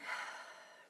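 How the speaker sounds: audible letting-go breath; demonstrated exhale sigh. Shown as a demonstration. Teacher models releasing unnecessary tension for listeners, audible sigh.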